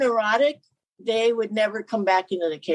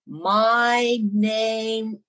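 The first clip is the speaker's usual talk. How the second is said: imitating someone talking to a foreigner; slow, drawn-out introduction; much slower than usual. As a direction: stretch every word out very slowly, as if they barely understand. Stranger addresses presumed non-English speaker.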